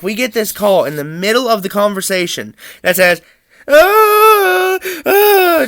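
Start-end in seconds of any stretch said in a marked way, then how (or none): 3.7-5.7 high wailing sobs ah ah; imitated bawling. Brother bawling to mom over the phone, wailing sobs. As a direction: wail like a panicked kid crying. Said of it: "just him bawling on the phone".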